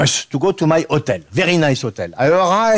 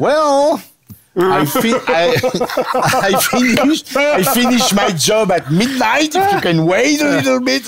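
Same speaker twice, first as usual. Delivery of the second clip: a bit higher, lilting waitress reply; higher, sing-song waitress; lightly voiced waitress; 8 semitones higher than usual. Waitress quote, raised pitch.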